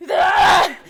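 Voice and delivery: short loud high cry; startled yell. Acted. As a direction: yell out in alarm. Player's character reacts, startled yell.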